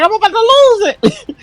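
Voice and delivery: squeaky high imitation of losing it; high mock freakout. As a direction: pitch way up like you're snapping. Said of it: "I be like".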